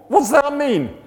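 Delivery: loud, high-pitched demand; baffled outburst. Retold or imitated. Workmate baffled by the verses, loud outburst. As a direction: burst out in loud bewilderment.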